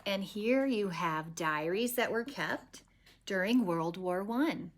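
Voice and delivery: ordinary delivery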